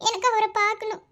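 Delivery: high, tearful begging; crying plea. Acted. Girl begging the doctor to let her see him, crying. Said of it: "இப்ப ஏன் நீ அழுதுட்டு இருக்க?".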